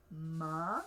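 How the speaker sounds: "ma" rising in pitch to the end; "ma" swept upward. Shown as a demonstration. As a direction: say "ma" with a rising tone, like a question. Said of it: "a rising tone".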